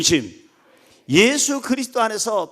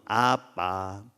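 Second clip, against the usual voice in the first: put-on small child answering; 10 semitones lower, 6 dB quieter, much slower than usual. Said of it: "애들이 어렸을 때는 아빠가 물어보면".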